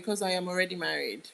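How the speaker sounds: normal talking voice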